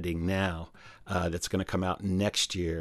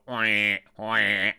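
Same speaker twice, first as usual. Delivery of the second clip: a slightly louder wah wah croak in another voice; spadefoot toad imitation; 4 dB louder than usual. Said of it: "the spadefoots go".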